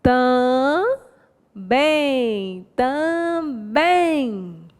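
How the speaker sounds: TAMBÉM drawn out syllable by syllable; TAMBÉM pulled apart to show the stress; stressed-syllable demo. Shown as a demonstration. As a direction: stretch the last syllable of TAMBÉM, slowly. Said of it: "posição da sílaba tônica".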